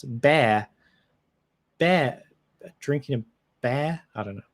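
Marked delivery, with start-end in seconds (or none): 0.0-0.7 UK accent demo. Shown as a demonstration. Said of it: "whereas in the U K". 1.8-4.0 UK beer accent. Shown as a demonstration. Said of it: "whereas in the U K".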